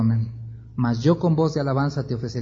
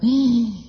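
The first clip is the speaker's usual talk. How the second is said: high startled gasp; a quick frightened intake of breath; the king gasping at the news; sharp gasp; 8 semitones higher than usual. Acted. Reaction to Jonás's warning.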